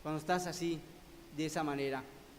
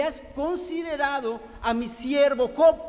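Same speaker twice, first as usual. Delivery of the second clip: higher, louder, slower proud voice of God; God speaking; 5 semitones higher, 8 dB louder, slower than usual. Acted. God asks Satan about Job, proud and booming. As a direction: boast about his servant like a proud father. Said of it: "le dice".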